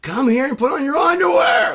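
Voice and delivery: high, full-volume furious shout; really mad wife. Retold or imitated. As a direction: scream the order while giving chase. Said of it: "she's really mad at him".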